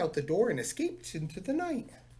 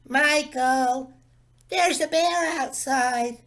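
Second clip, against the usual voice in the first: put-on high, loud, slow kid's voice, a little wide-eyed; a small boy bursting in with news; excited little boy; 8 semitones higher, 7 dB louder, slower than usual. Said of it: "said Carl".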